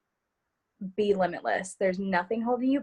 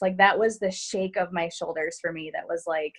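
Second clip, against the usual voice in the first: ordinary talk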